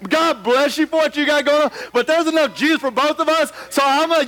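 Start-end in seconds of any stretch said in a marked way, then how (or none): none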